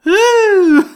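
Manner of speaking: high, loud drawn-out ooh; exaggerated ooh. Shown as a demonstration. Showing the host overdone ooh sounds in fake Scottish accents, exaggerated ooh. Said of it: "ooh sounds".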